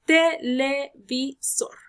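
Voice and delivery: "Televisor" slowly as te, le, vi, SOR; syllable by syllable. Shown as a demonstration. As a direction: space out each syllable of "Televisor", landing on SOR. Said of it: "lleva en la última sílaba". ("Televisor" lento, sílaba por sílaba, hasta SOR)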